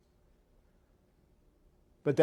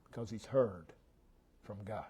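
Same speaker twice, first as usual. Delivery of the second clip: 1 hushed; 7 semitones lower, 16 dB quieter than usual. Real, not acted. Fading hush.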